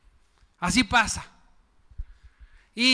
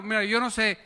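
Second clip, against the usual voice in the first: usual speaking voice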